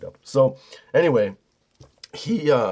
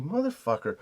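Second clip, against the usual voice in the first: muttered under the breath, softer; mumbled curse; 3 semitones higher, 7 dB quieter than usual. Retold or imitated. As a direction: grumble a swear word quietly to yourself.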